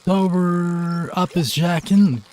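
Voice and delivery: put-on high, loud pleading drunk; drunk driver pleading. Drunk driver pleading with the officer, high and loud. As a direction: beg the cop like a tipsy driver.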